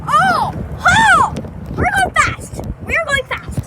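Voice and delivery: high, loud excited yelling; thrilled screaming. Real, not acted. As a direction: shout with the rush of speed. Speeding downhill, screaming.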